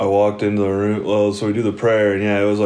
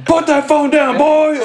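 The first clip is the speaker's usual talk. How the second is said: much higher mock drunk threat; angry drunk uncle; 4 dB louder, slower than usual. Drunk uncle ordering narrator off the phone, mock threat. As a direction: bark it like a furious drunk old man.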